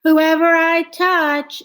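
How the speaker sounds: high, loud playful game call. A narrator's reading. Francine starting a tag game, playful call. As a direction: call out the game rules with glee. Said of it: "said Francine".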